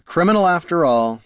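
put-upon, theatrical sigh; sighing lament. A narrator's reading. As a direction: sigh it out as a complaint. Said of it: "sighed Haraldin".

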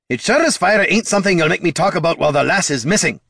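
fast, high, loud retort; angry snap. A narrator's reading. Dwarf cutting off the bard, fast angry retort. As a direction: snap back and shut the argument down. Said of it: "snapped Gorm".

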